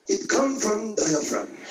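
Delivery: low, slow imitated Japanese accent from the diaphragm; deep, drawn-out Japanese-accent impression; Japanese man accent. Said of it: "When a Japanese man talks".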